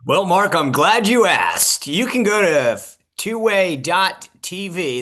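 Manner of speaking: mock game-show presenter. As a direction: sell it like a cheesy TV shopping host, punching the key words. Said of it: "Thank you, Vanna".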